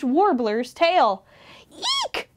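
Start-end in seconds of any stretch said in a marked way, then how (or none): 1.7-2.3 very high-pitched eek; tiny, piercingly high squeak; mouse squeak. A narrator's reading. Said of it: "squeaked Mouse".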